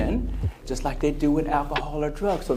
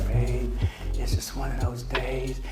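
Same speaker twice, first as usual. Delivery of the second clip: moping low; 4 semitones lower, 7 dB quieter than usual. Retold or imitated. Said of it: "her frequency was real low".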